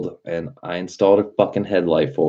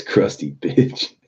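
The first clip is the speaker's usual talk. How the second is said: slow, breathy sneering voice; mock sneer; 3 semitones higher, slower than usual. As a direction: drag it out with disdain. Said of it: "Mad Doctor says".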